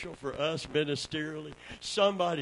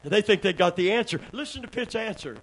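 ordinary talk, nothing marked

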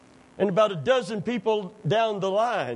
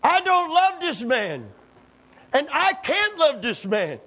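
higher, louder preaching with a shout at the peak; full-force preaching; 5 semitones higher, 4 dB louder than usual. Acted. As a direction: cry the prayer out to the church. Preaches Corrie's prayer at full force.

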